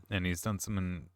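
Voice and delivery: ordinary delivery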